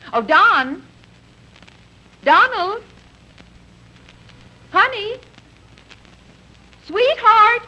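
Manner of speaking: calls from sweet to a loud yell; building calls. Acted. Wife calling husband, building to a yell.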